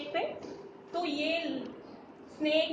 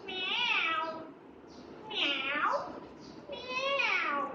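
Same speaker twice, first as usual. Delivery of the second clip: high mewing "म्याऊ", voice changed, last one much higher; cat meow imitation; 6 semitones higher, much slower than usual. Shown as a demonstration. Teacher showing the class how a cat meows, high playful mewing. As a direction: meow like a kitten, pitch climbing up. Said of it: "cat की sound क्या होती है?".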